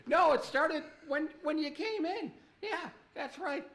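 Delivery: hurt comeback. Acted. Victim answering back the one who blames everyone, high mock protest.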